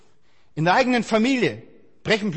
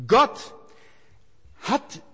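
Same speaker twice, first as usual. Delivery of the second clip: ordinary talk, nothing marked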